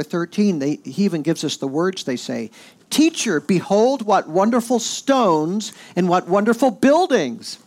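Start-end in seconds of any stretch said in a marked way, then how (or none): 2.9-7.6 high, loud, drawn-out wonder; excited disciples. Acted. Disciples show Jesus the temple buildings, all excited. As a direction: exclaim it in awe, stretching the praise. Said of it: "They're all excited".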